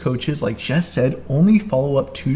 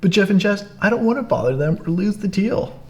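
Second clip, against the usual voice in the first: higher-pitched mock objection; objecting coach; 7 semitones higher than usual. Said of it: "hear a lot of coaches that say that".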